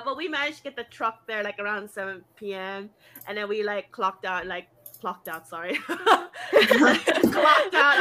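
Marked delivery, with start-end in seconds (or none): 5.8-8.0 loud, higher, laughing through it. Real, not acted. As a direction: crack up at your own slip. Cracks up at own slip.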